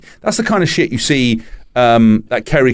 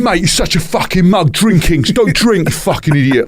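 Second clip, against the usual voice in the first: mock football fan jeer; 7 semitones higher than usual. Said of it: "all the football fans going".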